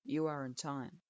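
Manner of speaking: put-on low, curt old teacher grading a student; a grudging low verdict; stern sensei voice. A narrator's reading. Strict sensei grudgingly approving his student, low and curt.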